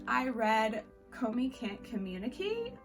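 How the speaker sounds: ordinary talk, nothing marked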